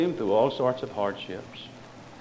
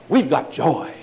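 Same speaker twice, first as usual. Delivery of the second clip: loud, high hammed-up boasting of joy; mock joyful preacher; 5 semitones higher, 7 dB louder than usual. Parodying preachers bragging of full joy, hammed-up. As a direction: ham up the televangelist's triumph. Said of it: "And then they'll say".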